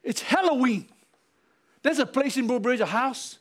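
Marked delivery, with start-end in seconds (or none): 0.2-0.9 "Halloween" driven home as HELL-oh-ween; Hell-o-ween pun. Shown as a demonstration. Preacher repeats his new name for the holiday, "Halloween" as HELL-oh-ween. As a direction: hit "hell" at the start of "Halloween". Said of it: "put a new name on it".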